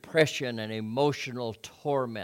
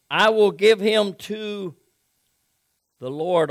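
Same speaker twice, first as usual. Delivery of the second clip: higher, louder, earnest plea to the Lord; pleading prayer; 7 semitones higher, 10 dB louder than usual. Acted. Hannah praying for a son, loud pleading. Hannah's tearful vow read aloud.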